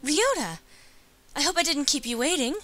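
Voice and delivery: put-on high, sweet girlish voice; heroine greeting friend. Voices Hiyoko greeting Ryota.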